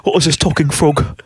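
baffled serious actor facing a talking frog; mock Cockney bewilderment, halting; Michael Caine. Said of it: "if we're gonna do Muppets and Michael Caine".

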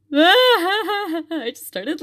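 real laugh breaking through the words; laugh-cry while remembering. Real, not acted. Laughs while recalling bawling.

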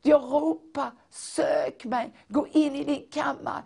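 high, urgent pleading proclamation; fervent prophecy. Acted. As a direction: cry out God's longing to his people.

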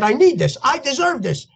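re-enacted indignant plea; pleading protest. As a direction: plead your case, voice climbing. Retold argument with sponsor.

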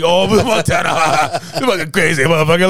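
high-pitched jeer broken up by laughs; acted ridicule, laughing through the words; mocking laughter. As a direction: imitate a friend laughing at you as stupid. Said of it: "this motherfucker called me, like, stupid".

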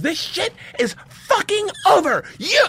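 high, drawn-out scolding yell; retold yelling. Teacher bursting in on student and mobsters, yelling. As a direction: imitate an outraged teacher, slow and high. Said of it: "starts yelling at me".

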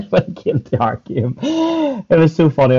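high, loud laughing through the words. Real, not acted. Player recalling the team talk, laughing. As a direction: crack up while telling it.

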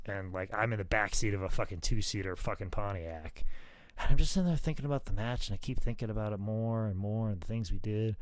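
normal talking voice

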